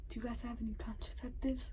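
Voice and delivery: hushed, breathy whisper of an embarrassed customer; whispered question. Retold or imitated. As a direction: whisper it so nobody else hears. Embarrassed ask at pharmacy counter.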